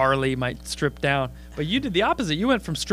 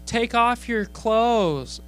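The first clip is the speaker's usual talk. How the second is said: slow drawn-out demand, a bit higher; drunk heckler; 6 semitones higher, much slower than usual. Retold or imitated. Drunk girl heckling the comic, slow mock demand. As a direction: mimic a drunk girl yelling. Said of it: "this girl goes".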